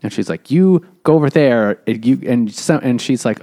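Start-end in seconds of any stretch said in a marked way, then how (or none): 0.5-1.8 louder, higher bossy command; mock bossy order. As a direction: bark out an order like a field nurse. Claire ordering men around.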